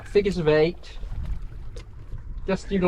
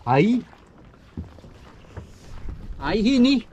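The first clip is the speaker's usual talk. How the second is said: put-on Geordie accent; 6 semitones higher than usual. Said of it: "My Geordie accent is appalling".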